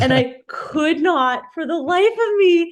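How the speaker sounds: loud, high-pitched exasperated storytelling; comic exasperation. Real, not acted. Patient telling podcast host about failing to fart, exasperated.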